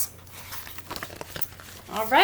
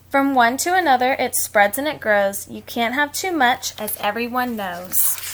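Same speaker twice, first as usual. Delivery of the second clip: reading a rhyme; 5 dB louder than usual. A narrator's reading. Reads rhyming book aloud.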